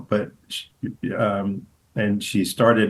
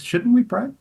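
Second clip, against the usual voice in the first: much higher, mock-innocent voice; Denzel's prayer prompt; 13 semitones higher than usual. Host imitating Denzel prompting prayer in a high, innocent voice. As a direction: ask it sweetly.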